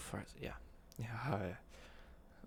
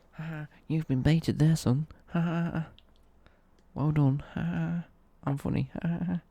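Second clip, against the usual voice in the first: loud, higher gloating with fake laughs; mock gloating; 5 semitones higher, 6 dB louder than usual. Streamer mimicking a troll who baited him, mock gloating. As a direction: crow it mockingly, with forced ha-ha-ha.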